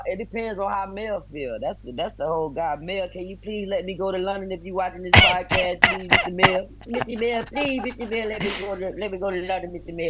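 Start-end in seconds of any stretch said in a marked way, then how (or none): 2.8-10.1 high, whiny pleading to the boss; playful pleading to Mel to let him go to London; mock begging. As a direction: beg like a kid asking permission.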